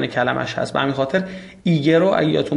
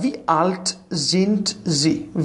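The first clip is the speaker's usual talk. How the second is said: very slow, deliberate German question; teacher spells out the question slowly for learners; slow model question; 3 semitones higher, much slower than usual. Shown as a demonstration. Teacher modelling for students, slow German question. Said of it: "teacher models German question".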